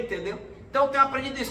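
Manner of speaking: ordinary talk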